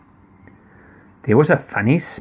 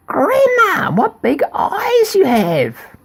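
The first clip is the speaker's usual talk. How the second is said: put-on girl amazed; slower than usual. Girl marvelling at grandma's ears, high and slow.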